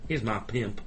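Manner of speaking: plain. Retold or imitated. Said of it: "That's nothing like what it sounds in the film".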